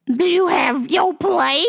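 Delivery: high slow mock old lady demand; demanding old caller. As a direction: drag it out like a stubborn caller. Caller asks.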